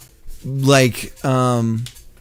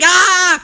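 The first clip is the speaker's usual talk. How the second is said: sudden high yelp of shock; high startled cry; startled yell; 6 dB louder than usual. Retold or imitated. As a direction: shriek like you just ruined your eyebrow. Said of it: "I was like".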